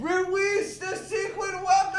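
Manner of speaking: lightly voiced cartoon quote; movie quote. Retelling the movie to a friend, high cartoon voice.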